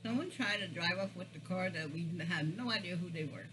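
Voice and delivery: talking with mouth full; muffled behind hand while eating; chewing food. Real, not acted. Seen 0: hand at mouth, eats food bites before and after; mouth working.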